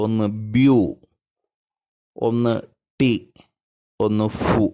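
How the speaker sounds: "ബ്യൂ", "ടി", "ഫു" each set apart by pauses; syllable by syllable. Shown as a demonstration. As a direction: count out "ബ്യൂ", "ടി", "ഫു" as separate syllables. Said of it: "ഏതൊക്കെയാണ് ആ സിലബിളുകൾ". ("ബ്യൂ", "ടി", "ഫു" ഓരോ സിലബിളായി നിർത്തി നിർത്തി)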